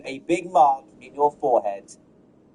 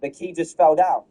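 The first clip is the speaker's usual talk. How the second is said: ordinary talk, nothing marked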